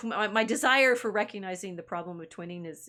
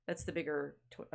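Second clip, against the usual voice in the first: normal talking voice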